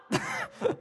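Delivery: high taunting laugh; mocking laugh. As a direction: laugh at him for the draft.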